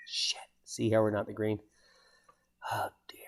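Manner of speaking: hushed, breathy aside under the breath; whispered aside. Real, not acted. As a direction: drop to a whisper as if sharing a secret. Muttered aside about missing rain.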